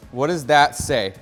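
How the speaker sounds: normal talking voice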